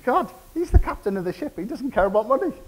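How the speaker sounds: high-pitched, exasperated inner groan; mock frustration at dad; exasperated thought. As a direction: son venting frustration about his stubborn father.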